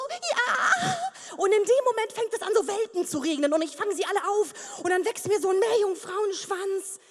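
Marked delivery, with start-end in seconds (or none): 0.1-1.0 high, joyful shout; yelled yes with a laugh; calling out. Pretend. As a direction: shout up to the sky. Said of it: "ruf so".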